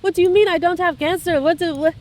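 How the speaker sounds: high, rushed unbelieving questions; patient reacting in shock to the doctor's words; stunned disbelief. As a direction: she can't take it in. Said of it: "She's like".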